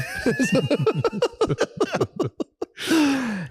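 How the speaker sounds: high wheezing laughter; high cackle; long laugh. Real, not acted. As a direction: crack up. Laughing at story.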